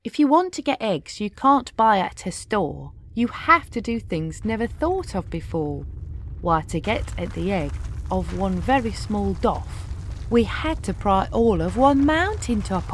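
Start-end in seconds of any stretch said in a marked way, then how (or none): none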